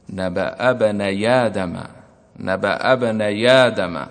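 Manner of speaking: slow, measured tajweed recitation; Quranic recitation. Shown as a demonstration. Teacher reciting the verse to students. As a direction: recite the verse slowly and deliberately. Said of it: "كقوله تعالى".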